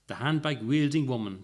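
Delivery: usual speaking voice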